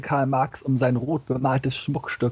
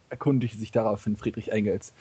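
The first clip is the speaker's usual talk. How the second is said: usual speaking voice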